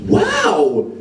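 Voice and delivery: mock amazement. Parisian congratulates a Belgian on his French, mock amazed. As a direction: imitate a clueless, impressed Parisian. Impressed ignorant Parisian, acted.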